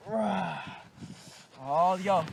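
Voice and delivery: ordinary talk, nothing marked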